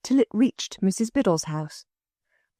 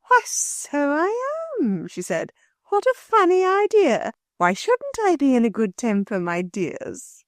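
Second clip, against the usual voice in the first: suddenly sweet as sugar; enchanted woman turned jolly with the children; jolly good temper; 6 semitones higher than usual. A narrator's reading. Angry woman suddenly turns jolly.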